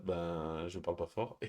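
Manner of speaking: parler doucement pour ne pas réveiller le bébé; voix retenue, plus douce; voix basse. Real, not acted. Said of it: "comme il y a le bébé qui dort". (voix basse pour le bébé malade)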